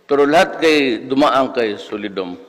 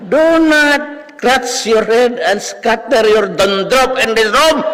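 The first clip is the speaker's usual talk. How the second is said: shrill mocking professor; 11 semitones higher, 6 dB louder than usual. Professor berating a student for scratching his head, shrill high tirade. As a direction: scold the dandruff scratcher in a screeching teacher voice. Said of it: "boses, ano, siya, shrill".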